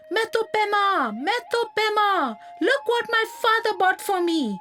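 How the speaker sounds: put-on eager boy calling to a friend; shouted call. A narrator's reading. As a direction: call out her name, bursting to share news. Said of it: "He shouted her name".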